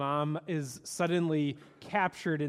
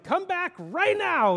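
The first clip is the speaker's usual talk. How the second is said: high, slow stern call-back; father yelling; 11 semitones higher, 4 dB louder, slower than usual. Retold or imitated. Marlin yelling at Nemo to return, stern high call. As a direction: bark it like a worried dad. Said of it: "Marlin's yelling".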